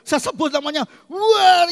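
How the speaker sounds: emotional retold cry; retold shout. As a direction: burst out with the name. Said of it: "malah teriak saya".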